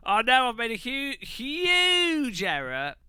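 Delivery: high, drawn-out wail on the mistake; mock dismay. Real, not acted. Streamer owning up to a huge error, exaggerated dismay. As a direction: stretch it out in comic alarm. Comic alarm at own mistake.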